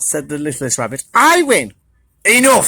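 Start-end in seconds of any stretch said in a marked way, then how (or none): 1.1-1.7 higher, bright claim; high little claim. Acted. Littlest Rabbit claiming the nut, high. 2.2-2.7 stern enough. Acted. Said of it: "said Badger".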